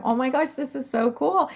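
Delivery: high, bright-eyed delight; watching a baby elephant play peekaboo, high and thrilled. Retold or imitated. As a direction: gush like you can't believe it. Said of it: "laughing and going".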